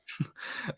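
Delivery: soft unvoiced chuckle; quiet laugh. Real, not acted. Laughing at the joke.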